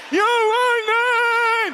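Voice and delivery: high, slow, loud cry of the name; shouted punchline. Real, not acted. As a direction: belt out the name slowly, way up high. Punchline cried out.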